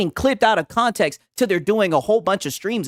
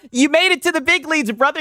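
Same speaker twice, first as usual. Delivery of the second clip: mock congratulations; 8 semitones higher than usual. Real, not acted. Streamer mocking Lono, high sarcastic cheer. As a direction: hype him up sarcastically.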